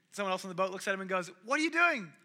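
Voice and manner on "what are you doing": shocked question. Retold or imitated. Shocked friend on the boat.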